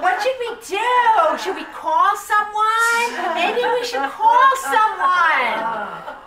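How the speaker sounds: a hammed-up Southern busybody fussing over a sign; imitating a Southern woman alarmed at kids in a tree, high and loud; Southern accent. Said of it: "My best Southern accent".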